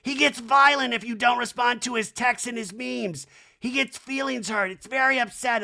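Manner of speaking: high-pitched hammed-up fretting; mock upset housewife. [name] explaining her texting husband's friend, mock upset. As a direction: keep up the imitation of a wound-up complainer.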